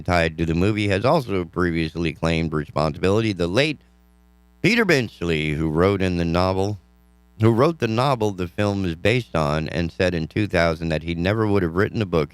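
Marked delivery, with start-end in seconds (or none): none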